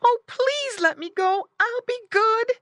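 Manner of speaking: very high, loud piglet plea; high pleading yell. A narrator's reading. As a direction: beg in a squeaky little pig voice. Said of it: "yelled Squinty".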